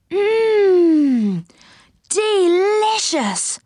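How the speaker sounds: long, high, drawn-out relish; blissful slow mouthful of praise; savouring delight. Acted. Rick savours a sweet cake.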